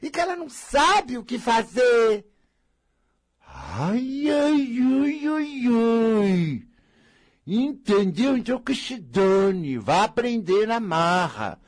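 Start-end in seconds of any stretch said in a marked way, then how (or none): 3.5-6.6 high whimpering ai, ai; mock self-pitying ai ai. Mocking the self-pitying woman, high whimpering. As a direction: whimper it like a martyr.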